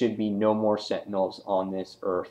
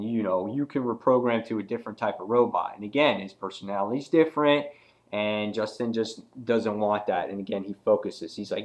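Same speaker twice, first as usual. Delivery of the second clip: normal talking voice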